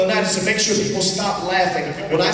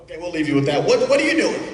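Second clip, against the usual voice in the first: normal talking voice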